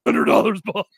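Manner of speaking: loud, high overexcited contestant shout; Lon swallowing the microphone. Retold or imitated. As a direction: blurt it right into the mic, way too excited. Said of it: "he's, like, swallowing the microphone".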